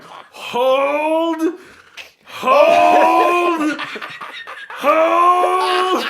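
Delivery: high, loud commanding shouts; shouting hold. Retold or imitated. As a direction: brace and bellow at the mouse. Bracing with broom.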